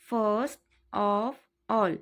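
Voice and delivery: very slow, careful repeat; drawn-out teaching repeat. Shown as a demonstration. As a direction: draw out every word for the class. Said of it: "teacher repeats phrase twice".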